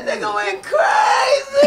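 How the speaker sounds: high, loud and slow yell; screaming with excitement. Real, not acted. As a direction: scream it out in hype. Hyped reaction in the room.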